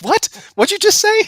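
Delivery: very high, loud disbelieving cry; mock shock. Guest retelling his reaction to the car owner, shocked. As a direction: squeal it in disbelief. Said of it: "I'm like".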